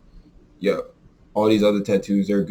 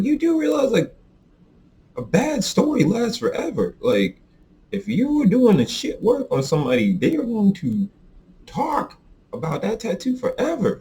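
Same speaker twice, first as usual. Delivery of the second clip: heated lecture; 13 semitones higher than usual. Retold or imitated. Lecturing homeboy about bad work.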